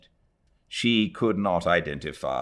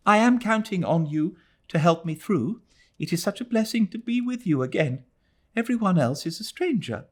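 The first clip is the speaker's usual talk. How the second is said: fast, eager rush of words; said rapidly; 13 semitones higher, faster than usual. A narrator's reading. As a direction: hurry through it, clinging and anxious. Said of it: "said the girl rapidly".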